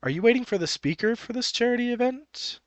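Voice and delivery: tentative question. Acted. Narrator voices OP checking with Happy, tentative. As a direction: venture it politely, voice lifted high. OP asks Happy in acted exchange.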